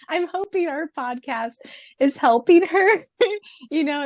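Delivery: high giggling hope; laughing. Real, not acted. Cohost laughing about cheering on the author. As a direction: giggle through the words. Laughing while hoping podcast helps.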